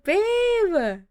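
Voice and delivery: high-pitched, playful squeal; gushing girlfriend hello; excited greeting. Pretend. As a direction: call to a sweetheart in mock excitement.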